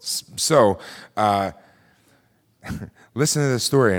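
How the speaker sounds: usual speaking voice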